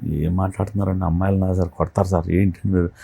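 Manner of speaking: put-on a bashful young man backing away; shy protest. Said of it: "అంటే సిగ్గేస్తుంది". (సిగ్గుతో మెల్లగా వారించడం)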